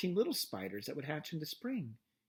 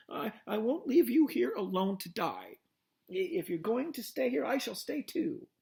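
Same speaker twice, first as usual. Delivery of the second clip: distressed; 8 semitones higher, 3 dB louder than usual. A narrator's reading. Wilbur pleads, stammering.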